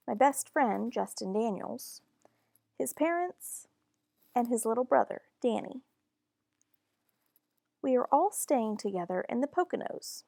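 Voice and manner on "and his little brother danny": usual speaking voice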